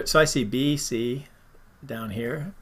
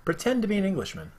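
quicker, higher mock English accent; 6 semitones higher, 3 dB quieter, faster than usual. Host retrying the saying in a mock English accent. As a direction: try the English accent another way. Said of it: "I said the English accent".

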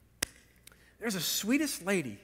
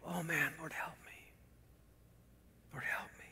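very soft, breath-only prayer; whispered plea; 14 dB quieter than usual. Real, not acted. Preacher asking the Lord for help, whispering. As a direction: sink to a whisper, pleading. Preacher prays, confronted by Jesus's example.

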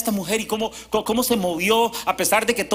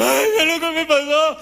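put-on much higher, louder excited old man; excited grandfather; 9 semitones higher, 5 dB louder than usual. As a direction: blurt it out like a startled grandpa. Grandfather sees drill injury. (abuelo alarmado, agudo y fuerte)

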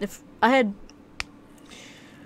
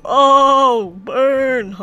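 loud, drawn-out crowing; mock-taunting shout; 4 semitones higher, 14 dB louder than usual. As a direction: crow loudly over your own joke. Crowing after a mom joke.

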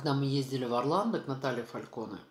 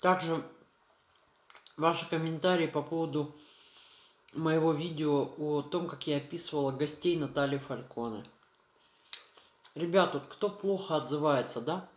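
talking between bites; chewing while talking. Real, not acted. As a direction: mouth full of food. Seen 1: Eats mushrooms from pan, forkful at 6.7, chews while talking.

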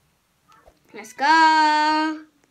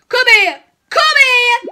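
chasing shout; 7 semitones higher, 7 dB louder than usual. Pretend. Seeker chasing hiders.

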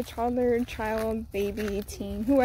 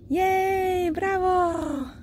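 high, delighted cheer; 8 semitones higher, much slower than usual. Real, not acted. Mom cheering her toddler after the shot, high delighted cheer.

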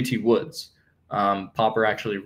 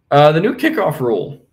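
voice cracking; 6 semitones higher, 9 dB louder than usual. Real, not acted. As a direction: voice cracks high and loud. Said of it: "I don't know why my voice cracked there".

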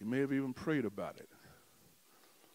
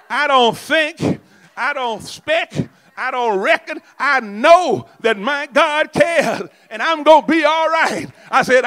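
loud rising preacher's cadence, hammering each line; preaching at full force; 12 semitones higher, 19 dB louder than usual. Acted. Preacher rallying the congregation to a shouting climax. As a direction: build the church up to a shouting climax.

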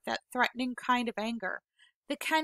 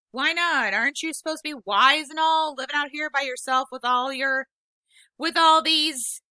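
frustrated back talk; 4 semitones higher, 8 dB louder than usual. Acted. Peter back-talking Vola, frustrated.

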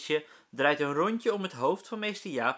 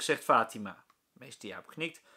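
ordinary delivery

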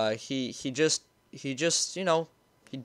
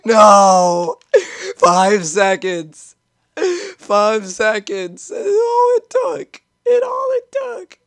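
loud, slow, crying wail, much higher at end; mock wailing; 6 semitones higher, 10 dB louder, much slower than usual. Real, not acted. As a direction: lament the loss dramatically. Lost game, mock wailing.

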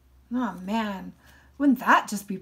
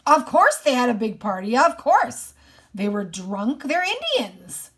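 loud, indignant rant, pitch jumping high; exasperated outburst; 3 semitones higher, 8 dB louder than usual. A narrator's reading. Boy hearing of the party before sister's death, exasperated outburst. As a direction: burst out loudly as if it were obvious. Boy's bitter outburst at the news.

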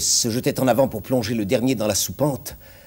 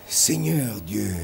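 low, slow, soft murmur; murmured prayer; 5 semitones lower, 6 dB quieter, slower than usual. A narrator's reading. Grégory calls on God as the monster appears, slow murmur. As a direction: murmur it slowly in stunned dread. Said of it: "murmura Grégory".

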